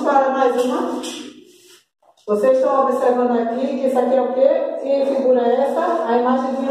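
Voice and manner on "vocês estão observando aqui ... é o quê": normal talking voice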